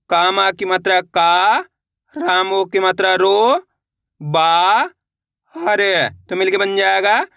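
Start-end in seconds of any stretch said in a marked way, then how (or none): 0.1-5.8 slow drill, "का", "रो", "बा" held long and rising; syllable-by-syllable spelling. Shown as a demonstration. Teacher leading learners to repeat along, slowly spelled out. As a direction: sound out each piece, pausing before "हर". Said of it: "मेरे साथ साथ इनको repeat करना है".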